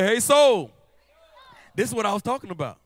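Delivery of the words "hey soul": loud, ringing cry of hey soul; shouted call to the soul. Shown as a demonstration. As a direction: call out to your own soul.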